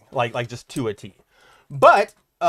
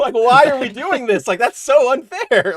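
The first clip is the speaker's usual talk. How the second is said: mock complaint; 8 semitones higher, 4 dB louder than usual. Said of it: "And I'm like".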